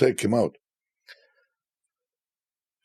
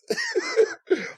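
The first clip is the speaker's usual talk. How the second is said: high burst of laughter; real laughter. Real, not acted. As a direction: laugh at the memory. Laughs after the story.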